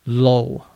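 low with the oh of go; model word. Shown as a demonstration. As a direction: say low like go and toe. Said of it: "Low is like the O in go".

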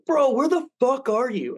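high, pushed impatient friend yelling down the phone; mock yell. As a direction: bark it like a friend who wants you there now.

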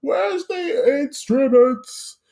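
high, slow grumpy grandpa voice; cranky old grandfather scoffing; old man voice. Retold or imitated. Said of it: "like an eighty-year-old grandfather".

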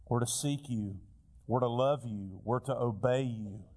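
usual speaking voice

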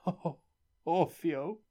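miserable cry. A narrator's reading. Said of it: "said the miserable man".

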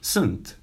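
sunt said soon with a round u; Romanian sunt. Shown as a demonstration. Teacher models sunt for students. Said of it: "or you can just say".

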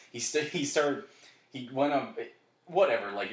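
ordinary delivery

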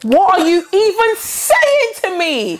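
slow, high, incredulous; mock baffled. Friend mocking the saying, slow and baffled. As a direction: stretch it out, baffled.

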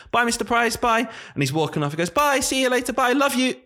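high sing-song goodbyes; high cheery kid farewell. Retold or imitated. Boy calling goodbye to teacher, sing-song. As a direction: wave off happily like a little boy.

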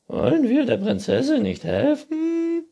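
very high, loud raccoon voice; Waschbär. A narrator's reading. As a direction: squeal the question. Raccoon figure asking. (hohe, laute Waschbärfrage)

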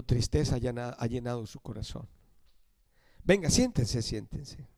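hushed, gentle invitation to sit; calm the grieving ones softly; soothing comfort. Acted. Jesús consuela a discípulos tristes. (consuelo tierno y en voz baja)

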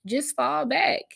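ordinary talk

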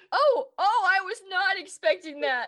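high, loud laughing oh oh; hammed-up surprise; mock shock. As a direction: act shocked and amused. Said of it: "I was like".

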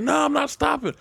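high, playful woman refusing; lightly voiced woman teasing; mock woman's voice. Retold woman's voice.

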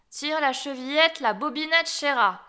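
wolf posing as granny, calling a little sweetly; wolf softening his voice. A narrator's reading. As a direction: call out, slightly softened, as fake grandmother. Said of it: "lui cria en adoucissant un peu sa voix".

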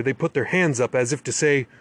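plain reading voice

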